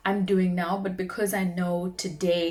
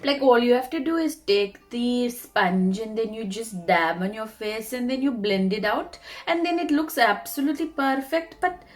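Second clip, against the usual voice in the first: lively, pitched-up Indian accent; 4 semitones higher, 4 dB louder than usual. Shown as a demonstration. Showing off her best accent to viewers, Indian accent. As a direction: do a makeup tutorial in an Indian accent. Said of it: "had to speak in an Indian accent".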